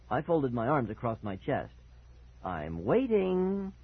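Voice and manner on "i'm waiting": very slow, high taunting lilt; happy singsong. A narrator's reading. Boy awaiting brother's apology, happy singsong. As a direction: stretch it out in a gleeful singsong. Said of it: "I sang happily".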